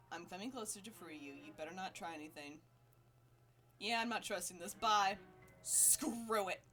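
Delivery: ordinary talk, nothing marked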